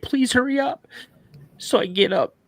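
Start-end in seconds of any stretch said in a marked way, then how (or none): 0.0-0.9 high-pitched, frightened plea; retold panic. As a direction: plead like a terrified little brother. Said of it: "this panic and this terror in my little brother's voice".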